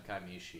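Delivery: "Kaimichi" with a soft sh in place of ch; sh sound. Shown as a demonstration. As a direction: say "Kaimichi" as kai-MEE-shee. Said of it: "more of a sh sound".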